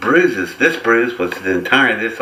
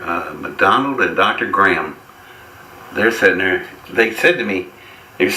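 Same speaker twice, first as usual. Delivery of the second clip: ordinary delivery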